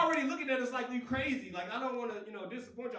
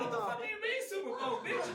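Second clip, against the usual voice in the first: incredulous laugh; 11 semitones higher than usual. Retold or imitated. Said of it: "I'm like".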